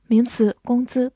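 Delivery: usual speaking voice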